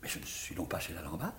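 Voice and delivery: dismayed whisper under his breath; whispered aside. Acted. Visitor realising he is in the wrong house, whispering aside. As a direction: hiss it to yourself, caught out.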